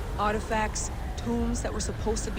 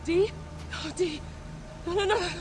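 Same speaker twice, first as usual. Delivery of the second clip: high, stricken disbelief; distraught; 12 semitones higher than usual. Acted. Layla calls to dead friend D, distraught. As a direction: refuse to believe the friend is gone.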